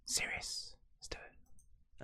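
hushed mock serious whisper. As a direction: whisper it gravely, half joking.

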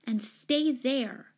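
slow, high, firm command; stern and drawn out; fierce. A narrator's reading. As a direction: order it fiercely, weighting each word. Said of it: "she said fiercely".

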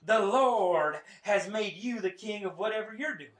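loud Southern preacher, drawn-out sermon; Joel Osteen. Retold or imitated. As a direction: proclaim it in a preacher's Southern accent. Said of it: "He's like, this Southern accent".

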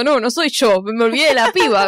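sudden alarm at having forgotten; mock realization. Friend voicing Molly realizing she forgot the girl, mock shock. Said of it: "abre los ojos como diciendo".

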